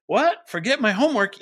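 put-on high, loud puzzled kid; confused kid. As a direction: squeak it like a baffled child. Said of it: "your kid is gonna say".

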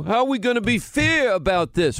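a hint of a whining New York governor; mock Cuomo whine. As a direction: put on the governor's voice to mock his complaint. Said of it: "based on what Governor Cuomo just said".

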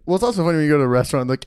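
ordinary talk, nothing marked

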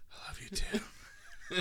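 whispered, slow and tender; mock whisper. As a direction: breathe it softly to a son. Quoting mama's tender reply.

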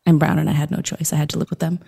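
low, hushed mock-intimate narration; ASMR storytelling. Guest telling their origin story as playful ASMR, hushed. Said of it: "I'm gonna do A S M R".

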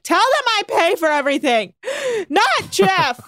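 high-pitched mock drunken yell. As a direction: holler it like an indignant drunk. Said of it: "really drunk Brandy keeps yelling".

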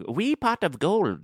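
slow, high Scottish-tinged Irish; botched Irish accent, leaning Scottish. Shown as a demonstration. Podcaster coaching the line, high Scottish-leaning Irish accent. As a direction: draw it out in fake Scottish-Irish. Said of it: "everything is leaning Scottish at this point".

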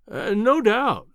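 slow, drawn-out, humoring an imbecile. A narrator's reading. Narrator humoring the boastful professor, slow and indulgent. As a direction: stretch it out as if placating a fool. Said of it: "as one humors an imbecile".

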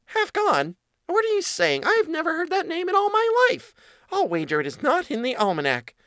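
very high squeaky mouse, settling a little at the end; incredulous mouse. Acted. Mouse doubting the child's name, very high and incredulous. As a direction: protest high in disbelief.

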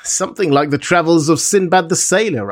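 usual speaking voice

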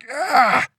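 high, loud growl of frustration; frustrated grunt. A narrator's reading. Girl lost for words at friends, frustrated grunt. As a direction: let out a wordless exasperated cry. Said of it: "Unable to find the words, Lillian just grunted".

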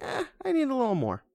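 high, put-on lukewarm whine; unsatisfied viewer. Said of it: "sitting there kinda like".

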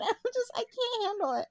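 high, laughing helplessly while speaking; words breaking into real laughter; cracking up. Real, not acted. Cracking up at Toad covers.

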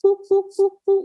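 high-pitched playful monkey hoots; rough attempt at a monkey impression, high hoots; monkey noises. Shown as a demonstration. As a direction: hoot like a monkey in short, even bursts. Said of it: "It's making monkey noises".

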